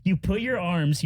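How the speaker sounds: ordinary talk, nothing marked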